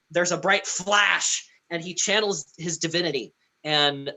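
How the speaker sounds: ordinary talk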